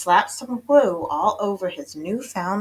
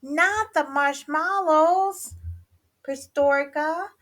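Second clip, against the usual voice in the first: shocked exclamation; 10 semitones higher than usual. A narrator's reading. Skipito hears of toasted marshmallows, exclaiming high. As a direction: exclaim it in stretched disbelief. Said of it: "exclaimed Skipito".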